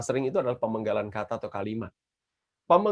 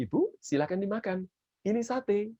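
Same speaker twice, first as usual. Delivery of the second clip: polite offer; 8 semitones higher than usual. Shown as a demonstration. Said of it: "kalau Anda berbicara pada seseorang".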